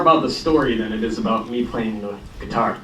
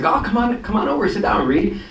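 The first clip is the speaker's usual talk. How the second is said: friendly invitation; 8 semitones higher than usual. Retold or imitated. Old schoolmate inviting them over.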